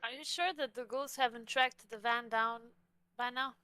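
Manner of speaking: ordinary delivery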